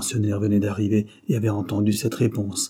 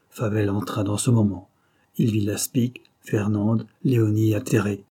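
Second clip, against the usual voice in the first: ordinary delivery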